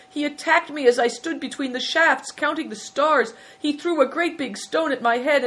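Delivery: high-pitched, breathless-victim storytelling; self-pitying victim. A narrator's reading. Hailstone reporting the fox attack to the prince, plaintive. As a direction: recount it as a wronged innkeeper, pitched high. Hailstone tells his tall tale.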